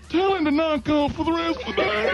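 prank-calling Ronnie in the owner's voice; Joe impression. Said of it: "do this impression so well".

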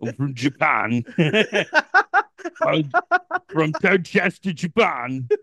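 mock Cockney, close to laughing; playful put-on Cockney accent; Cockney British. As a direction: introduce yourself in comic Cockney. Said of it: "Cockney British?".

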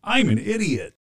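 high, swooping silly voice; put-on catchphrase. Husband cuts in on the podcast host, high silly voice. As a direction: blurt the catchphrase high and sing-song.

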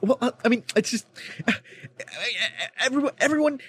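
high, stammering, whiny mock student; flustered student impression. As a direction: play the rattled classmate groping for words, pitched high. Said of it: "the student starts going".

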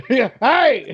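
mock dad yell with some laughter. Shown as a demonstration. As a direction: call out like the man of the house. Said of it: "the dad voice, the dad yelling".

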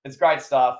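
normal talking voice

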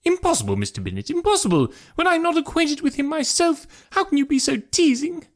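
very high-pitched, protesting wife; high, exasperated mother voice. Acted. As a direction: protest to your husband in a high put-on voice.

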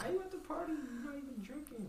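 high-pitched mock girl voice; mimicked party girl. Co-host imitating a girl urging drinks, mock high voice.

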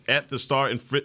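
normal talking voice

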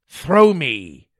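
loud, urgent cry; shouted command. A narrator's reading. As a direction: call out the order at full voice. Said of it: "he shouted".

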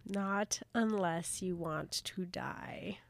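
slow, drawn-out eerie whisper; ominous whisper. Acted. Co-host echoes the warning just read aloud, ominous slow whisper. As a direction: whisper it as a chilling warning, very slowly. Whispered echo of the threatening line.

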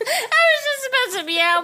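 high giggly voice; laughing while trying to talk; hiccup giggles. Real, not acted. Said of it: "trying to stop laughing".

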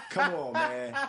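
high burst of laughter then come on man; laughing. Real, not acted. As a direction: crack up, then protest. Laughs at reporter memory.

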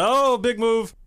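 imitating an announcer's loud, high pop; excited announcer reaction. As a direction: call out a big moment like a commentator. Mimicking announcers' big pop reaction.

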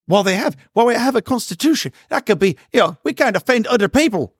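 high-pitched mock Irish accent. Mocking Irish lawmakers in a put-on Irish accent. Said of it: "had to do the Irish accent".

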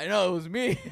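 loud, high blurt bursting into a laugh; retold excited admission. Boy tells his friends how he owned up to the girl, excited blurt.

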